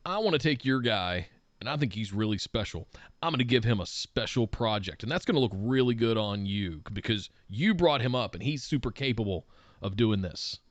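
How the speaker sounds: low, soft executive pitch; lightly voiced CEO. CEO pitching a special project to a vice president, lightly acted. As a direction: lightly play a boss, low and quiet. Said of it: "the C E O comes to you and says".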